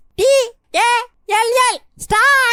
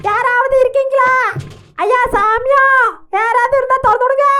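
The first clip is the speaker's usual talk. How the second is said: louder pleading call; calling for help; 2 semitones higher, 3 dB louder than usual. Acted. Locked in the room, calling loudly for help. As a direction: call out to be let out.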